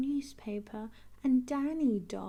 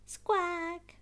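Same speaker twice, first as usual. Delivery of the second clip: parrot squawk; 7 semitones higher, 4 dB louder than usual. Acted.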